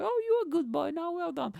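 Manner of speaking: very high, cooing praise; patronising praise. Acted. Imagined waitress praising a good boy, high coo. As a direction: praise him like a little pet. Voices the praise he had wanted.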